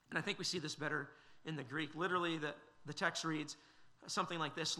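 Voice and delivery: usual speaking voice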